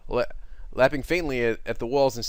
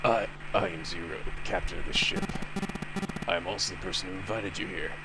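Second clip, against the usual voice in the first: low villain voice; 5 semitones lower, 4 dB quieter than usual. Acted. Zero's low voice.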